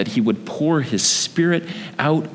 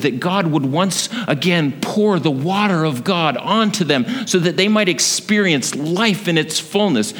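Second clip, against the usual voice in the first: ordinary delivery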